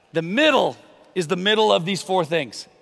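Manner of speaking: ordinary delivery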